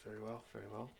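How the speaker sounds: soft, resigned compliance; obedient guard. Acted. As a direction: give in meekly as a servant. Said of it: "he's like".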